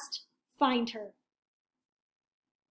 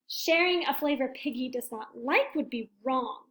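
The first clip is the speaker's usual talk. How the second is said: usual speaking voice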